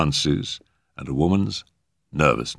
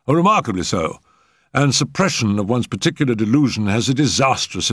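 higher-pitched, emphatic learned doctor; eminent physician lecturing at table; 3 semitones higher, 3 dB louder than usual. Acted. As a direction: hold forth as the great man, stressing key words.

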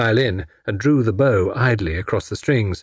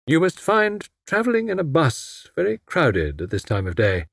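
tired tone; 7 semitones higher than usual. Acted. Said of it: "remarked in a tired tone".

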